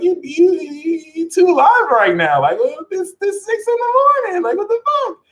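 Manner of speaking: high, whiny exasperated complaint; annoyed Taurus. Retold or imitated. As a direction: squeal like a groggy sleeper dragged out of bed too early. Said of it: "the Taurus looks at Leo like they extra".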